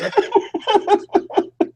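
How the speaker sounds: high-pitched drawn-out laugh; real laugh. Real, not acted. Listener reacting to friend wanting to quit, laughing.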